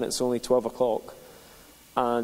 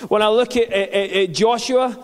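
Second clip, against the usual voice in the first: rising, louder preacher's exhortation; fervent pulpit preaching, slowed on key lines; 6 semitones higher, 5 dB louder than usual. Acted. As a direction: drive the message home to the congregation. Preaching to church.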